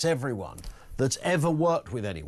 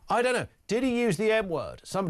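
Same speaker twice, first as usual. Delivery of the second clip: ordinary talk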